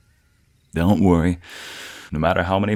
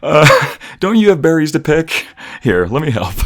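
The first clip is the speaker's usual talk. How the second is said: teasing deflection; 10 semitones higher, 10 dB louder than usual. Acted.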